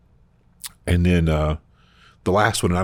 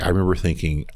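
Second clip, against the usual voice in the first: normal talking voice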